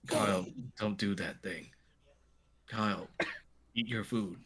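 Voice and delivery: a lightly done stern dad; a child mimicking his father, flat and scolding; mock Kanan. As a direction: kid imitating a strict parent. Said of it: "Kanan sounds like this".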